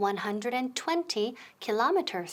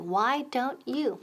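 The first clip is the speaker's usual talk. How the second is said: slow model phrase; slower than usual. Shown as a demonstration. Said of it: "So again".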